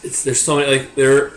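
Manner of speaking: normal talking voice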